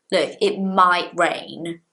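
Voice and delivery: "might" pushed a little, slow and firm; stress on might. Shown as a demonstration. Teacher shows students how to stress "might". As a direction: lean on "might" to make it strong. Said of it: "making the word might stronger".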